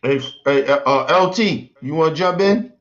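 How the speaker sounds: ordinary talk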